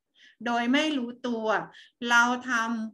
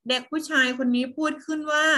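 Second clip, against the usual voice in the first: normal talking voice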